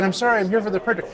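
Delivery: high, quick mock-panicked apology; flustered apology. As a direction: act out apologizing in a hurry. Retold apology to swearing stranger.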